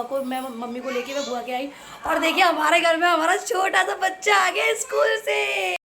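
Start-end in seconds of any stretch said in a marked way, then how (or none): 2.1-5.8 high, loud, gleeful announcing; excited announcement. Real, not acted. Aunt announces the little one home from school, excitedly. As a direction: sing out the news, pitched way up. Excited greeting of returning child.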